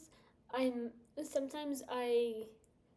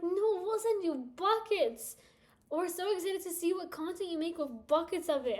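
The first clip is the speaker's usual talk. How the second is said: eager company rep; 6 semitones higher, 5 dB louder than usual. Pretend. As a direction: gush like an overeager brand. Company insisting, high pitched.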